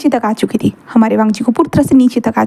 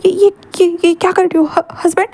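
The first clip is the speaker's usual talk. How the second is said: startled stammer; 6 semitones higher than usual. Acted. Wing lifted bridal style, startled.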